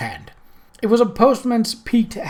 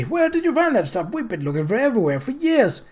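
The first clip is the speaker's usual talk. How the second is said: put-on much higher, eager old man; excited; 8 semitones higher than usual. A narrator's reading. Groat asks Moist where he found the hat, excited. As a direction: rush it out amazed. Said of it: "said Groat excitedly".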